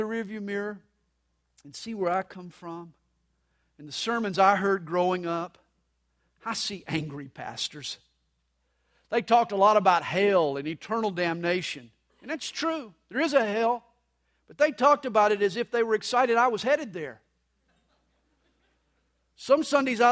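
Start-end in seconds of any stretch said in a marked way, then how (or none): none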